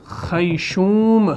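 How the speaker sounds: خیشوم drawn out very slowly, syllable by syllable; خیشوم said slowly with the خ clearly rasped; slow pronunciation. Shown as a demonstration. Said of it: "کیا کہتے ہیں؟".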